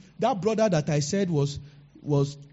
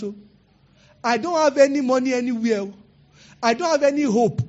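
raised, pleading prayer cry; impassioned prayer; 5 semitones higher, 4 dB louder than usual. Acted. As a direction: beg God for a word.

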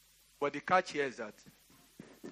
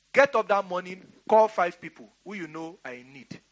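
preached out loud, first words pitched up; loud command; 2 semitones higher, 8 dB louder than usual. Real, not acted. Pastor commanding the church to give, loud. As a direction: drive the charge home.

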